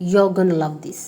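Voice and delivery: "You're gonna" run together; shortened form. Shown as a demonstration. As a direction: reduce going to into "gonna". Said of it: "you can say".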